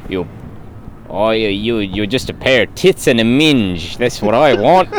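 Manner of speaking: a hint of a crude Australian pub bloke; mock Australian. Friends riffing on a pub scene, mock Australian. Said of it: "you went Australian".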